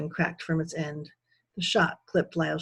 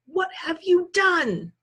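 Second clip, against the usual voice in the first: high, slow angry outburst; 13 semitones higher, 4 dB louder, slower than usual. A narrator's reading. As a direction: demand it in shocked fury. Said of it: "Anger coursed through Lyle's eyes".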